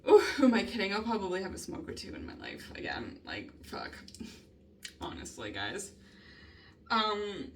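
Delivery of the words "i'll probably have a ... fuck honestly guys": soft, unvoiced aside; hushed, much softer confession; whispered confession. Real, not acted. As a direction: admit it under your breath. Confessing aside under her breath.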